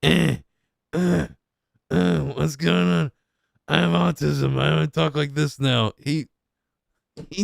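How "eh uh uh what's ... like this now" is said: mocking his put-on voice. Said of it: "he always does that, like".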